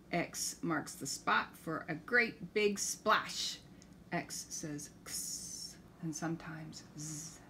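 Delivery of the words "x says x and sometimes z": soft whispered aside; whispering. Acted. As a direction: whisper it like a secret. Whispered letter sounds.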